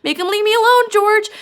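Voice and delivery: Lenny begging George while being hit, high frightened cry; frightened crying plea. Retold or imitated. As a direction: cry out for George like a scared child. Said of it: "Lenny starts crying for George".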